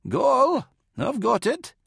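high-pitched medic's voice; Dorden, steady medic. Acted. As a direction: take charge of the wounded man. Dorden takes over from Kalia.

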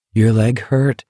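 plain reading voice

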